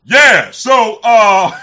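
full-on loud high shout; Zach's yell. Retold or imitated. Imitating Brother Zach yelling at the camera. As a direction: bellow it like a drill sergeant. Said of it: "Brother Zach be yelling at you".

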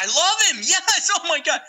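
very high squealing fan; high-pitched squealing fan voice; gushing fan. Retold or imitated. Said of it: "You, you like Darth Vader?".

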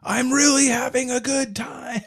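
very high, strained, forced cheer; fake-happy bar reveler. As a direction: force the fun like a drunk trying too hard. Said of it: "it's the classic".

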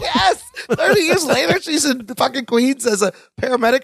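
high, excited laughing outburst. Real, not acted. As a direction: burst out in amused disbelief. Excited disbelief at plot.

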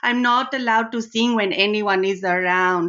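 a hammed-up Indian auntie accent, full of darlings; Rani's Indian accent. Host plays Rani greeting Karen, in a playful put-on Indian accent. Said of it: "I've got Rani sitting next to me".

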